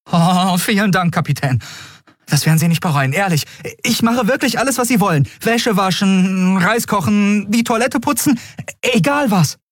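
put-on very high, eager boy voice; excited young lad's high voice; overjoyed boy. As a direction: gush thanks and promises, pitched right up. Boy gratefully promises any chore.

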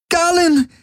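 very high-pitched alarmed scream; Kirai screaming. A narrator's reading. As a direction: scream the name in horror. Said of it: "hörte er Kirai schreien". (hoher, entsetzter Schrei)

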